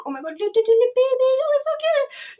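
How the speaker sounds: put-on cooing baby talk. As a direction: coo over the little buds like a newborn. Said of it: "you're like".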